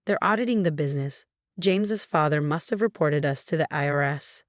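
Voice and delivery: usual speaking voice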